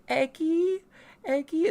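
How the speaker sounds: high-pitched searching call; questioning call. Acted. Penta all alone looking for the egg, questioning call. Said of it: "He's asking".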